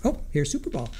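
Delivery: ordinary talk, nothing marked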